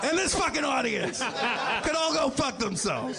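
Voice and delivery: high-pitched mock tirade; mock denunciation. Comic cursing his own audience for laughs, mock denunciation.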